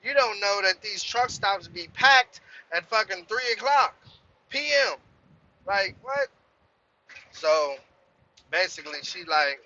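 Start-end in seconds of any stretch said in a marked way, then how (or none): 0.0-6.3 higher, heated swearing back at her; retold angry rant. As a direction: replay the blow-up, worked up and higher. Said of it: "And I'm like".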